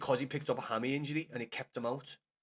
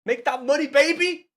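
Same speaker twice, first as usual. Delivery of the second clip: gleeful cheer; 10 semitones higher, 10 dB louder than usual. Real, not acted. Gloating over gifts and views.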